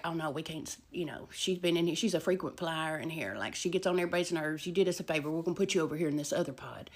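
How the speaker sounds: low, soft acted aside; confiding deputies.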